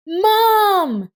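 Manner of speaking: put-on high, loud child's cry of joy; joyful shout of a little boy. A narrator's reading. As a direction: call out to his mother.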